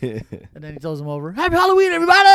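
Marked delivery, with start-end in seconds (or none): 1.3-2.2 high, loud imitation shout; gleeful holiday yell. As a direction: holler a festive greeting to the arena. Acts wrestler yelling as he throws rival.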